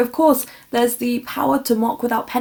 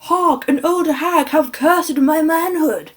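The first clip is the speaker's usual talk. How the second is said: high, slow hammy jester cry; mock-archaic stage proclamation; mock theatrical shout; 6 semitones higher, 4 dB louder, slower than usual. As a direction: imitate a bad jester declaiming his punchline. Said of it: "they'll shout".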